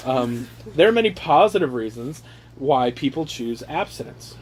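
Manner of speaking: normal talking voice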